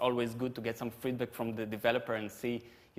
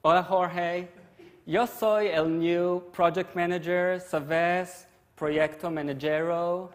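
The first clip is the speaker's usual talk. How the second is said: put-on slow, loud, higher-pitched gringo Spanish, American accent; 5 semitones higher, 5 dB louder, slower than usual. New project manager introducing themselves, loud American-accented Spanish. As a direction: speak broken Spanish like an American. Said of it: "doing it in American accent".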